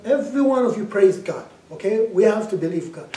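rally the room to praise; loud exhortation. Retold or imitated. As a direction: exhort them forcefully, loudly. Commands mourners to praise.

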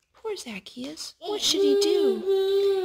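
slow, sorrowful sigh over poor Zacchaeus; pitying. A narrator's reading. Reading to a child, slow pity for Zacchaeus. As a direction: feel sorry for him, drawn out and slow.

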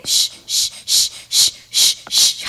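sharp repeated shushing bursts; imitated whooshing. Real, not acted. Player urging Ricky to jump, sharp whooshing. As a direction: puff out short, forceful shh noises. Makes noises while moving character.

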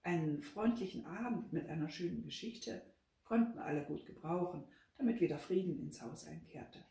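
ordinary delivery, nothing marked

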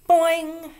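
high, loud springy boing cry. A narrator's reading. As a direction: shout a bouncy boing. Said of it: "she cried".

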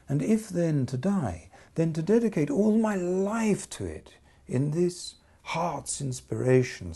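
impassioned vow; passionate outcry. Acted. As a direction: cry it out with devotion.

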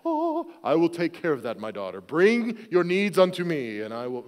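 grand, swooping saintly proclamation; mock righteous. Dad pretending his heart was righteous, mock-saintly proclamation. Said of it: "my heart was righteous".

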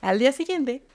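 normal talking voice

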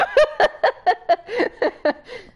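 loud squealing laughter; high giddy laugh. Real, not acted. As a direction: laugh with pure delight. Laughing at greatest news.

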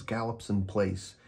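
ordinary delivery, nothing marked

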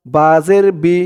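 ordinary talk, nothing marked

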